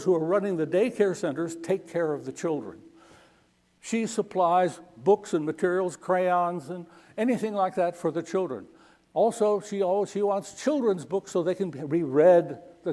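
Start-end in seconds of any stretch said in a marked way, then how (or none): none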